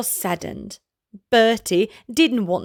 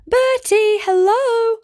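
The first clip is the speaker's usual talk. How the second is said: much higher, louder friendly call; 12 semitones higher, 4 dB louder than usual. Acted. Alfie calling to the buffalo, high eager call. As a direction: hail him from across the pool.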